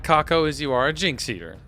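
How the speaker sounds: ordinary talk, nothing marked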